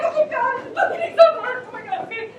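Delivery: high, loud frantic cries; hysterical panic. Acted. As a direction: panic over the body. Panicked over shot body.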